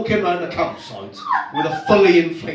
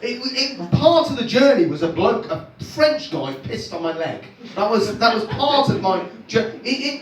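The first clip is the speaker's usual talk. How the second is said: usual speaking voice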